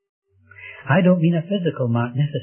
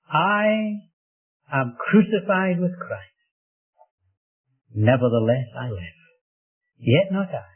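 emphatic recitation. Acted. Preacher quoting Paul to the congregation, deliberate and emphatic. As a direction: declaim the verse phrase by phrase, dropping at the end. First, emphatic quotation of Paul's verse.

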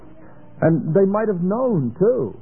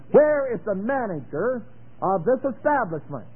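demanding loud; 5 semitones higher than usual. Shown as a demonstration. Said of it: "doesn't that sound meek".

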